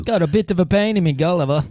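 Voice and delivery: bad put-on English accent; terrible English accent. Having a go among friends, terrible English accent. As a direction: attempt an English accent, badly. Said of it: "that's a terrible English accent".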